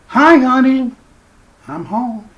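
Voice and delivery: high, loud homecoming call, easing off at the end; Jack in The Shining. Acted. Movie character busting down the door to greet honey, hammed-up call. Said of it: "you will be like Jack in The Shining".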